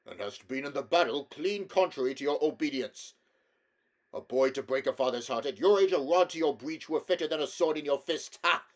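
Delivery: loud gruff fatherly rebuke; a king gruffly scolds his boy; gruff scolding. A narrator's reading. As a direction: scold with bluster hiding pride. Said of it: "said the king in a very gruff voice".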